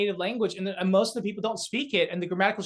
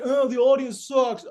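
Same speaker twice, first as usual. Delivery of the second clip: slow, higher, louder mocking whine; mock whiny complaint; 6 semitones higher, 5 dB louder, slower than usual. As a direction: sneer it like a sulky comic blaming the crowd.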